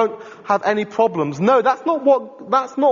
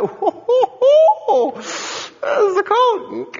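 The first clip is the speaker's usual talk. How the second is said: much higher, slow, strained mock yelp; seraph with tongs; 12 semitones higher, much slower than usual. Preacher mimics the seraph flinching from the hot coal, squealing high. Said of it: "It's like".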